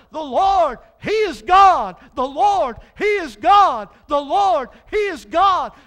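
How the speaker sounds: high, loud shouting praise; The Lord, He is God shouted again and again; shouting crowd. Acted. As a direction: scream it like the whole crowd on the ground. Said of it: "screamed and hollered".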